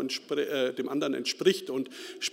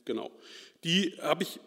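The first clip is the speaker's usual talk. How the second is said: usual speaking voice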